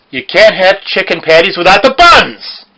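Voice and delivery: sudden bellowed outburst at full volume; loud shout. Acted. Blurting a wild shout between two jokes, bellowing. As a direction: yell it out of nowhere, drawn out.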